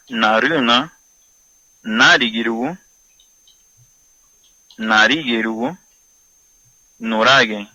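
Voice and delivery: each Garifuna word said slowly and loudly, one by one; slow word list. Shown as a demonstration. Teacher reading Garifuna words to radio listeners, slow and loud. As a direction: spell out every sound for the students. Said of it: "continuamos con la clase de garífuna".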